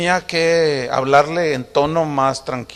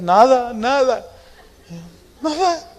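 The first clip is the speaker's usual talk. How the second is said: high, mock-weepy denial; tearful "nothing's wrong", hammed up; imitated tearful wife; 7 semitones higher, slower than usual. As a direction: play a crying wife, pitch climbing. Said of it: "de pronto llegaba y estaba llorando".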